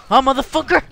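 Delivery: high, loud challenging shout; shouted taunt. Real, not acted. Player taunting his game opponent, shouted taunt. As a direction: yell the challenge in his face.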